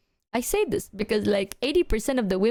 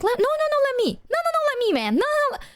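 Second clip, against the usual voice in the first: squabbling friends; faster than usual. Retold or imitated. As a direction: squeal no over and over to grab the bill. Friends fighting to buy drinks.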